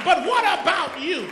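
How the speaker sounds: loud challenge, falling at the end; preaching challenge. Acted. Pastor confronting the church, loud challenge. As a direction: drive the question home at the crowd. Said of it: "repeated challenge".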